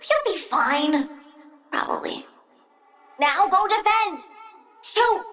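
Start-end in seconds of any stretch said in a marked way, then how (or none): 1.7-2.2 whispered aside. Acted. Sly aside after reassurance.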